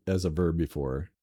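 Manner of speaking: ordinary delivery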